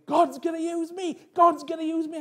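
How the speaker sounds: high, elated exclamation, far above normal pitch; excited. Retold or imitated. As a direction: jump for joy as you call it out. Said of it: "I was so excited".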